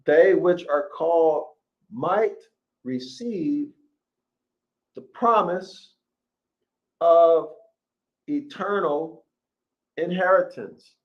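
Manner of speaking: slow dramatic Bible reading; scripture reading. Acted. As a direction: proclaim each phrase like a preacher at the pulpit. Continues the verse, raised.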